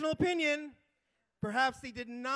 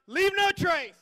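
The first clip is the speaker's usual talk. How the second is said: loud, high rally cry; slogan shout; 7 semitones higher, 11 dB louder, slower than usual. Real, not acted. Manager rallying the crowd, loud shout. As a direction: call the slogan out.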